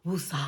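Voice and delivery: soft, low sigh before woosah; calming breath out. Real, not acted. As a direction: breathe it out to calm down. Calming herself in the bathroom.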